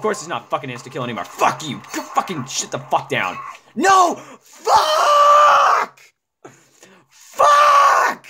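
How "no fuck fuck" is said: very high piercing yell; high shrieking outburst; screaming rage. Real, not acted. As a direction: scream it as you die. Gamer dies, screams.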